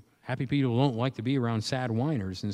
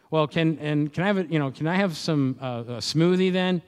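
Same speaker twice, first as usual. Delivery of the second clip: put-on higher, louder pleading child voice; whiny kid; 5 semitones higher, 5 dB louder than usual. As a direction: play the kid nagging for a snack. Kid badgering parent for snacks.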